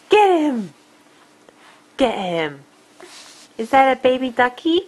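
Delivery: normal talking voice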